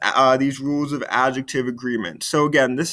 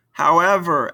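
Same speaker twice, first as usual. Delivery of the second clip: normal talking voice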